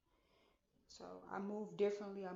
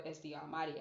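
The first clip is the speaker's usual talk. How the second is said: ordinary delivery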